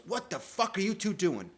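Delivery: high, loud furious mom voice; angry mom. Retold or imitated. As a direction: play the mother yelling at the kids. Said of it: "my mom goes".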